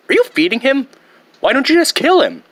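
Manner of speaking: high, fast, whiny protest; exasperated protest. Acted. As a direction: complain at dad in disbelief. Son protests to father.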